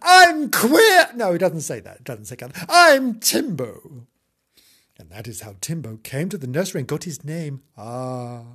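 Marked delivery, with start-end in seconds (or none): 0.0-1.1 high, loud theatrical proclamation; triumphant announcement. Acted. The cat springs out of the corner, proclaiming. 2.7-4.1 slow, higher self-introduction; proud cat. Acted. The cat presents itself to the children, proudly. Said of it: "said Timbo and sprang out".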